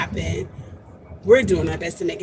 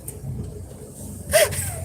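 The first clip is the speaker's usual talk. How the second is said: giggle; 7 dB quieter than usual. Real, not acted. Laughs at own joke.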